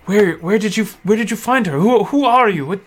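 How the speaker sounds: excited father asking the rescuers what happened; agitated father. Acted. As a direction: fire questions, still shaking. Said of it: "and he said".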